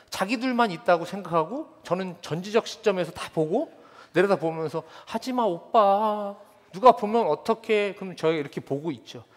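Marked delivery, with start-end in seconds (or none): 5.1-7.9 put-on high, slow, coy girl pleading; teen girl voice. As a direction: play a shy girlfriend begging, drawn out. Teen girl voiced in sermon story.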